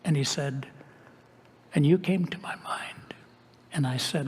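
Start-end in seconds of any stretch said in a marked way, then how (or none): 1.7-3.1 hushed, awed whisper; whispered revelation. Retold or imitated. Friend whispering what came to him in prayer. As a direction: let it fall to a whisper like a confided secret. Friend recalls God's words.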